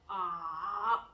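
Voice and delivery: mock pained groan, dipping and rising. Shown as a demonstration. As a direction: demonstrate a twisted-stomach groan, drawn out. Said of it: "you're groaning in pain".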